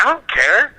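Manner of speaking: high, petulant dismissal; a high, huffy "don't care" aimed at his friend. Retold or imitated. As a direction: toss it off like a sulky kid. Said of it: "I'm like".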